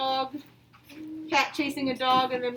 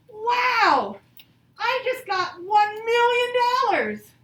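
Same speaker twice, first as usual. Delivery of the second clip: high, loud, thrilled cry of "Wow"; excited exclamation; 7 semitones higher, 7 dB louder, slower than usual. Shown as a demonstration. Teacher shows her class an exciting sentence, high and loud. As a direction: sound like you just won a fortune. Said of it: "sentences that show a lot of feeling, excitement".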